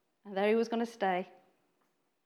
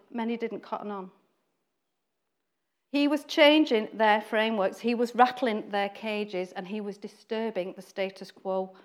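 ordinary talk, nothing marked

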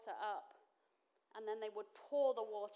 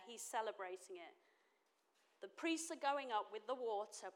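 normal talking voice

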